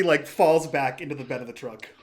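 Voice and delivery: ordinary delivery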